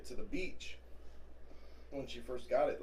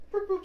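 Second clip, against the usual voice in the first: remote beep imitation; 3 dB louder than usual. Imitating car remote beeps.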